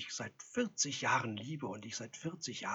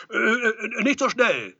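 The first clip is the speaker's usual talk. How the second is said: loud, high, stammered objection; flustered protest; 12 semitones higher, 14 dB louder, slower than usual. A narrator's reading. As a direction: stumble in, then call out to stop him. Flustered Edelstein stops the visitor.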